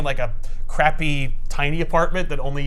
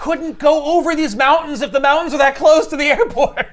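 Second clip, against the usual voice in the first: laughing through the objection, higher; 10 semitones higher, 4 dB louder than usual. Real, not acted. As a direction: protest while cracking up. Joking argument about the film.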